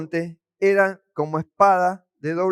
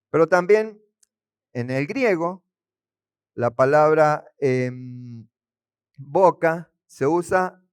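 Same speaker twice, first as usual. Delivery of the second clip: ordinary talk, nothing marked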